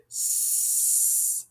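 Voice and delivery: "s" as one long hiss; letter sound. Shown as a demonstration. Teacher sounding the word's ending, hissed and held. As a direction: hold "s" as a long hiss, not the letter name. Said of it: "then we hear".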